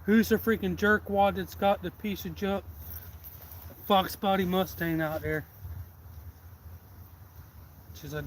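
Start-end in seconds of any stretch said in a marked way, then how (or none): 0.0-5.5 higher and louder, taunting demand; mock angry challenge. Guy in the store taunting the Mustang owner, mock angry. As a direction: call out the owner like a jerk. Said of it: "And he's like".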